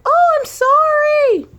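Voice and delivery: high, slow, squeaky put-on apology; mock cashier apology. Cashier apologizing for the short change, high squeaky voice. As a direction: chirp it like a flustered cashier. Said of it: "She was like".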